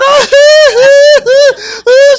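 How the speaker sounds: loud, very high shrieking cackle; piercing hee-hee-hee, hammed up; imitated hysterical laughter. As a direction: mimic a wife's helpless high-pitched laughing fit. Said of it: "And she just laughing".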